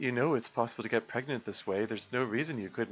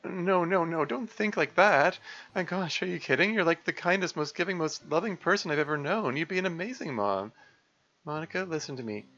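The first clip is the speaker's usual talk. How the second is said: tender comforting voice; 10 semitones higher than usual. Acted. Voicing the tearful couple scene.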